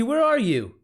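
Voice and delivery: ordinary talk, nothing marked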